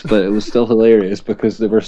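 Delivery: usual speaking voice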